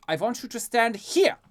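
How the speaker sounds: high, loud, commanding; stern Lucas voice. Acted. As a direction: order him firmly. Lucas instructing.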